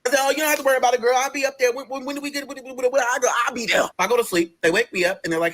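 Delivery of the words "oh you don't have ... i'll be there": high-pitched, stumbling eagerness; eager compliance. Retold or imitated. Patient told skipping class means staying, suddenly eager. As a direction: hurry to agree, tripping over the words.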